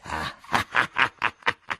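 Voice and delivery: a short, satisfied laugh; the captain chuckles with delight; pleased laugh. A narrator's reading. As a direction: laugh at being proved right. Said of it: "rise contento il capitano".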